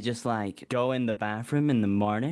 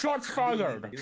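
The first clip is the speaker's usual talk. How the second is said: higher, hammed-up radio call; mock cop callout; 8 semitones higher than usual. Joking to the police squad, a mock cop callout. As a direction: bark it like a play cop.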